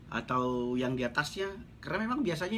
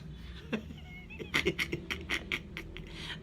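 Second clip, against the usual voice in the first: high laugh; 8 dB quieter than usual. Real, not acted. Laughs at leaders' pride.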